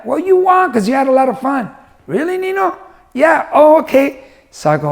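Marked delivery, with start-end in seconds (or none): 0.1-1.7 high, cheering godfather voice; upbeat encouragement. Retold or imitated. Godfather tells girl she won, upbeat encouragement. 2.1-4.2 very high, childlike imitation; little girl. Girl believes her godfather, little-girl voice.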